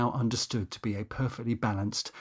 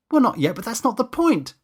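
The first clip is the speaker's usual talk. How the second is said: shouted; 10 semitones higher, 6 dB louder than usual. Acted. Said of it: "Danny shouted back".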